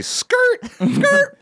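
very high squeaky double yelp; mock a rap ad-lib in a tiny squeal; mimicked "skirt" squeal. Teasing friend about the way they say it, squeaky mimicry. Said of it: "the way you say".

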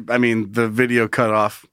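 usual speaking voice

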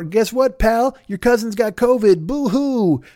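mock cameo greeting. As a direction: rib a buddy in a silly voice.

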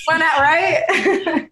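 slow, high and laughing. Real, not acted. Guest joking back at the host, laughing. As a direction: laugh it out playfully. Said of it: "getting your book chapters".

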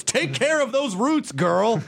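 put-on high, slow sassy girlfriend voice. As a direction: drawl it like a sassy friend. Sassy advice to [name].

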